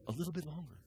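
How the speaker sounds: suddenly soft, small answer; hushed divine reply. Acted. God answering the waiting believer, hushed anticlimax. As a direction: drop right down, softly, after the shouting. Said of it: "and he says".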